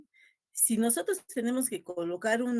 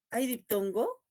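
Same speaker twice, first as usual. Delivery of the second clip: ordinary delivery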